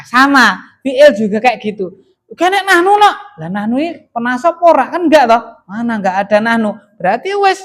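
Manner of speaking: normal talking voice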